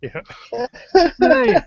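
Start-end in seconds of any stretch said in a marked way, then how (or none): 1.2-1.6 mock Australian call. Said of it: "I'm your Prime Minister now".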